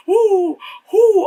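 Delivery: high loud woo woo; mock cheer. Real, not acted. Cheering Sidney grabbing the poker, high mock whoop.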